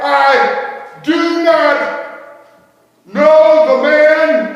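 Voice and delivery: very slow, high, full-voiced shout; shouted denial. Peter declaring before them all, shouting. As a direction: bellow it, stretching every word. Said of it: "I declared before them all".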